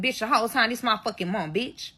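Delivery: normal talking voice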